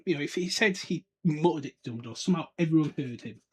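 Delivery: usual speaking voice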